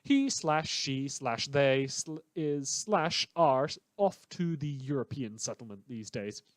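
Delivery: ordinary talk, nothing marked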